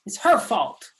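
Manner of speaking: loud, high accusing shout. Acted. Both girls shout blame at each other. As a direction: yell it pointing at her. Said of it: "we shouted together".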